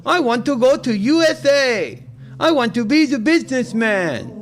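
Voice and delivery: mock foreign caller. Mocking caller with silly voice.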